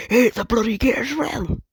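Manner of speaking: loud, high orc yell. Acted. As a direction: roar it out like an orc. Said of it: "I'm acting like a fucking orc".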